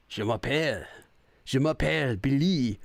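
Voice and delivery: "je m'appelle" in a hammed-up old-style Paris French accent; mock Paris French accent. Shown as a demonstration. Streamer showing off the only French he knows in a mock Paris French accent. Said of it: "say it with, like, the stereotypical Paris, uh, French accent".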